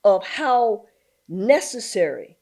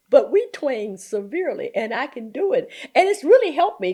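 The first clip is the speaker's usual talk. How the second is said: ordinary delivery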